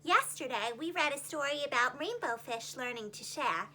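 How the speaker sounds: usual speaking voice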